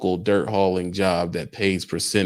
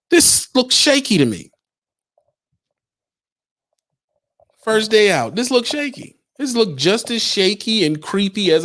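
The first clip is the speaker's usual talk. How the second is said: mock outrage; 11 semitones higher than usual. Mocking local guys' claim.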